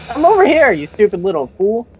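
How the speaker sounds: high, loud put-on taunt; Mister T. Player taunting a lost teammate, mock Mister T. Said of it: "What am I, Mister T".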